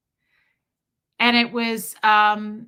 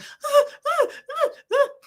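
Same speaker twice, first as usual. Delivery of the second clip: imitated dog whine; 3 dB louder than usual. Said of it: "Gertie will be standing ... going".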